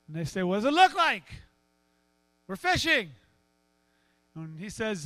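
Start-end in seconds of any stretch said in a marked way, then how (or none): 0.4-3.1 retort. Acted. Annoyed fishermen answer back.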